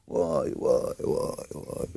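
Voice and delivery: frightened hushed whisper; terrified hush; scared whisper. Acted. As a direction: gasp it in fear under your breath. Scared man in the forest.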